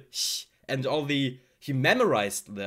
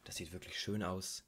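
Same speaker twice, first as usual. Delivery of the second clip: very soft, lower-pitched German; 6 semitones lower, 14 dB quieter than usual. Shown as a demonstration. Showing fans German can sound soft, spoken softly. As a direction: say it softly and gently, barely projected. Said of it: "You can say it soft".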